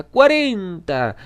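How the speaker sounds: loud, high, long-stretched bidding cry; slow, ringing shout to top a rival; drawn-out shouted bid. A narrator's reading. Escalating auction bidding war.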